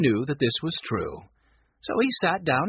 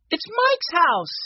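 put-on high-pitched, drawn-out boy's yell; yelling big news as a young boy; excited yell; 14 semitones higher, 4 dB louder, slower than usual. A narrator's reading. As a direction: cry it out high and slow. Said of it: "yelled Benny at the top of his voice".

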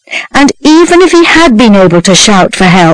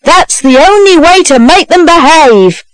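high-pitched, emphatic endorsement; approving cry; 7 semitones higher than usual. A narrator's reading. Man at his window approving the punishment, high emphatic call. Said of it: "cried a man".

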